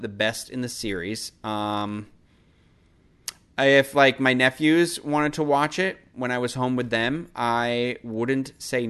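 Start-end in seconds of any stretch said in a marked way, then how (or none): none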